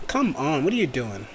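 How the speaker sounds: soft exasperated aside; annoyed mutter. Real, not acted. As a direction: grumble quietly at the misbehaving program. Annoyed at drawing software.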